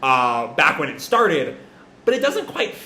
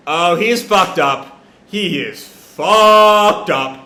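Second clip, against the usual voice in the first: slow, louder, drawn-out disbelief; stunned reaction; 6 dB louder, much slower than usual. Real, not acted. Reader reacting to the dark panel, slow stunned disbelief. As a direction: linger on each word in shock. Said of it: "own stunned reaction".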